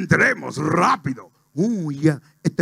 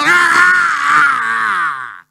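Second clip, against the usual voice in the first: long, high, loud commentator scream; held "Ah" yelled like a goal call; screamed goal cry; 6 semitones higher, 9 dB louder than usual. Acted. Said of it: "no puede gritar una cosa así". (grito de gol largo y a todo pulmón)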